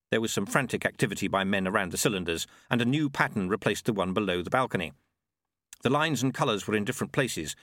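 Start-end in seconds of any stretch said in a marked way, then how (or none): none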